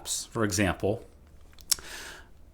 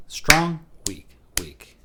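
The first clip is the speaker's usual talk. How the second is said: chanting a triple meter accent; strong weak weak; 5 semitones higher, slower than usual. Shown as a demonstration. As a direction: punch Strong, let weak weak fade. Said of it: "let's practice that twice in a row".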